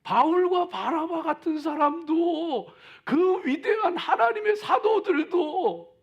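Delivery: loud, high sermon fervor; booming motivational speech. Acted. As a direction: preach it out full force. Preaching at full force.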